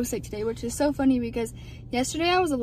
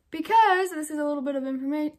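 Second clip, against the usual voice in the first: usual speaking voice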